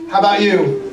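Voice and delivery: ordinary delivery, nothing marked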